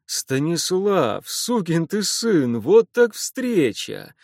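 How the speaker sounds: joyful greeting. Acted. Cossack hugs his lost friend.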